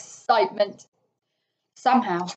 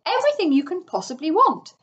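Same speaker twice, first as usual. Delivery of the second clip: proudly listing, lilting up high; mildly crowing show-off; triumphant; 7 semitones higher than usual. A narrator's reading. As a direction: reel off the treasures with pride. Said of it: "said the Toad triumphantly".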